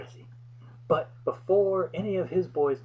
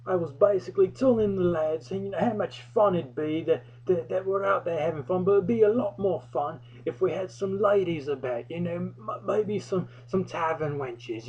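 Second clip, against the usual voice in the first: put-on English accent; 6 semitones higher than usual. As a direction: play the cheeky English lad. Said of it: "I began using an English accent".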